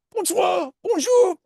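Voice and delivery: put-on very high French-accented squeak; terrible French accent. Potato salad greeting the family, high French accent. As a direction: pipe up loudly in cartoon French. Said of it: "you have a terrible French accent".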